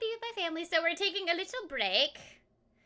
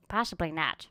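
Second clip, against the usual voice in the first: 1 low voice; 14 semitones lower, 5 dB quieter than usual. Pretend. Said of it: "repeats phrase in voices".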